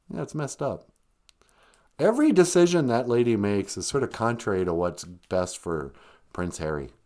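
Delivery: ordinary talk, nothing marked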